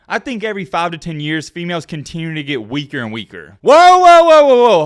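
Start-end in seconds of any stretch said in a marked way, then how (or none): 3.6-4.8 loud, high startled halt; loud whoa. Real, not acted. Vlogger stops himself mid-rant, loud and high. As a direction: cut yourself off loudly.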